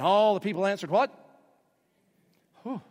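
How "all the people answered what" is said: call to congregation. Real, not acted. Preacher prompts congregation response.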